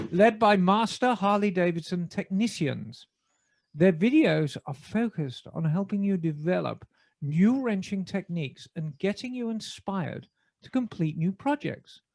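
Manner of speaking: measured BBC announcer reading an ad. Acted. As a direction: deliver the sponsor read in your best BBC British. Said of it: "in my best B B C voice".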